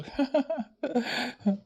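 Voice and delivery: high, self-satisfied chuckle; smug chuckle. Acted. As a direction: laugh at your own success. Pleased with himself. (स्वतःवर खुश हसू)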